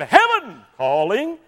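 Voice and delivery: Brother Mays's greeting. Retold or imitated. Pastor answering the phone with his cheerful slow greeting. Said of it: "He'd say".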